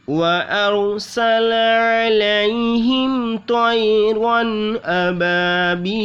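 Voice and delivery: slow, drawn-out tajweed recitation; slow melodic Quran recitation; Quranic recitation. Acted. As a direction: chant the verse melodically. Recites the full verse.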